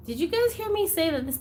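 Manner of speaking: ordinary talk, nothing marked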